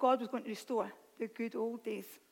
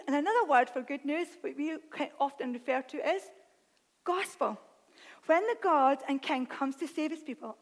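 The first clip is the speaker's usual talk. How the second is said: ordinary talk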